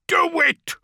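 high, loud roaring order; bellowed. A narrator's reading. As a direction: bellow the command. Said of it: "Beaumont bellowed".

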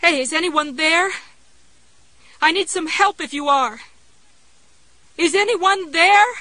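high, loud desperate calling. A narrator's reading. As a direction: call out into the empty house. Calling out for help.

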